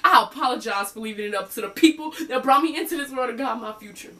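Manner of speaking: high, loud, angry outburst; acted heated rant, building to a peak; [name] venting. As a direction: let it all burst out at the therapist. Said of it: "finally had a chance to do a little venting".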